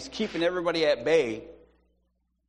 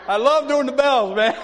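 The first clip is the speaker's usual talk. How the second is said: loud, high, gleeful laughing; 9 semitones higher, 9 dB louder than usual. Real, not acted. Preacher tickled by his own bell imitation, laughing. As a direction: blurt it out through laughter.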